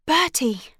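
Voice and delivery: out of breath from hurrying; panting. A narrator's reading. Amanda arriving late on her bicycle, panting. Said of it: "she panted".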